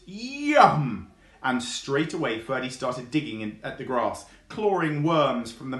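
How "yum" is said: high, loud burst of delight; gleeful yum. Acted. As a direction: squeal with hunger. Fox sees earthworms.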